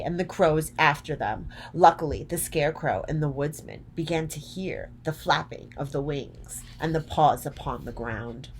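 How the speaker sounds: plain reading voice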